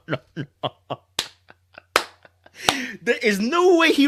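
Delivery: slow, high, laughing no no no; cracking up, can't believe it; laughing disbelief. Real, not acted.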